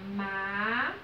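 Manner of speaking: MÁQUINA broken up with long pauses, MÁ... QUI... NA; low, held syllables of MÁQUINA, one at a time. Shown as a demonstration. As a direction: slowly separate the three syllables of MÁQUINA. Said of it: "Qual foi a sílaba mais forte?".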